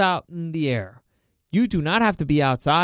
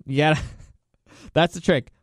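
usual speaking voice

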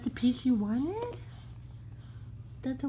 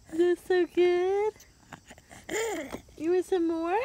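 put-on baby talk; 3 semitones higher than usual. Feeding baby green beans.

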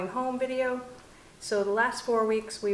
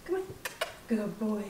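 ordinary talk

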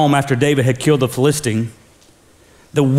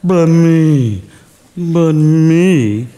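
louder, whining "But me"; whiny self-pity; 4 dB louder, much slower than usual. Shown as a demonstration. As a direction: stretch "But me" like a jealous child complaining. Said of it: "say it like that".

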